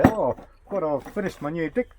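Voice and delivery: hammed-up Kiwi vowels; mock Kiwi accent. Kiwi quoting how Australians hear them, mock Kiwi accent. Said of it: "when we say".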